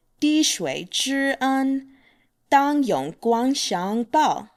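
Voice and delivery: steady careful repeat of "滴水之恩"; proverb at moderate pace. Shown as a demonstration. 用中等速度再说一遍成语.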